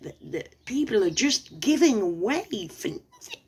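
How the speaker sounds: a hammed-up gleeful idiot; sarcastic mockery. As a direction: ridicule the scammer's silly plan. Mocking scammer's thoughts.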